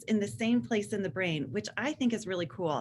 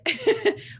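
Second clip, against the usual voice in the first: high laugh; 13 semitones higher, 4 dB louder than usual. Real, not acted. Laughs at teen tirade story.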